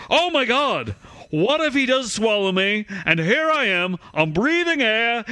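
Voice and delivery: hammed-up Massachusetts accent, frantic quote; attempted Massachusetts accent. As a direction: Thurston Howell-style Massachusetts accent. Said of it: "fucking Thurston Howell?".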